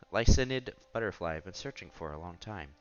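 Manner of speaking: normal talking voice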